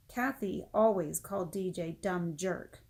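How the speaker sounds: usual speaking voice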